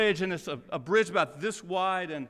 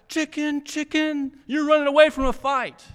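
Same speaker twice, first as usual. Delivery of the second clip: higher, louder, taunting jeer; a knight jeering at the king who walks away; trash talk; 8 semitones higher, 6 dB louder than usual. Retold or imitated. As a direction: taunt the retreating king like a schoolyard bully. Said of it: "still talking trash".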